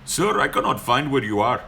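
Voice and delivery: a hint of a mock Indian accent; polite complaint in a light put-on Indian accent; lightly imitating a lost driver's Indian accent; lightly done Indian accent. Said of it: "and he's like".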